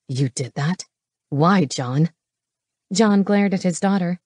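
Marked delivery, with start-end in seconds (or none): 0.1-2.1 low menacing growl. A narrator's reading. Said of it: "Knox growled".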